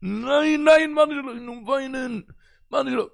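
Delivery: high desperate crying refusal; wailing no. Retold or imitated. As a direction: cry it out, refusing to budge. Boy wails refusing to go on. (ווייניק, הויך)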